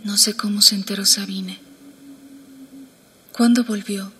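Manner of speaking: hushed story reading; quiet, confiding narration. A narrator's reading. Reads novel excerpt, hushed.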